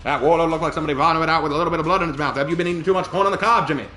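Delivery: put-on fast, loud old-time announcer; old radio announcer. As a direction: rattle it off like vintage radio. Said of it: "Back to you".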